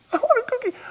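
very high mock toddler whine; toddler on a loop. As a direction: cut in as an insistent two-year-old. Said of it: "she was on this loop".

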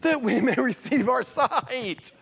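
high, slow, pleading with a laugh breaking in; pleading blind man. Acted. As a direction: ask for sight, hoping and half laughing. Blind men answer Jesus.

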